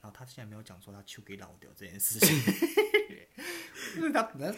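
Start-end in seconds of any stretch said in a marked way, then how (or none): 2.4-3.9 loud high laugh; high burst of laughter. Real, not acted. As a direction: crack up at own story. (自己大声爆笑)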